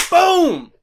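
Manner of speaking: loud hyped shout. Real, not acted. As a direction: belt out Boom with excitement. Hyped exclamation after intro.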